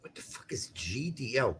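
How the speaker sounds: whispered under the breath; a hushed, baffled whisper; whispered aside. Real, not acted. Muttered aside while reading.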